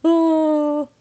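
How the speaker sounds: long, high, loud wail; imitating crying down the phone; hammed-up sobbing "ohh"; mock crying wail. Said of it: "broke down crying on the phone".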